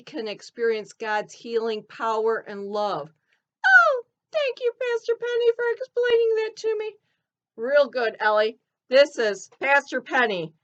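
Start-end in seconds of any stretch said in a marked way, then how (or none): 3.7-7.0 high, squeaky cartoon elephant. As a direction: chirp it like a little elephant puppet. Ellie thanks the pastor.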